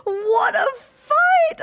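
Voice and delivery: high, loud, slow, half-crying; tearful cheer. Real, not acted. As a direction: cheer through tears of joy. Overwhelmed after beating boss.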